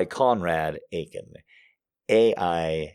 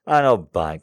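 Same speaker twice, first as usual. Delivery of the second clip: louder, punchy disbelief; loud teasing; 9 dB louder than usual. Acted. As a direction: say it again, louder and bolder.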